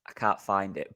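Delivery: normal talking voice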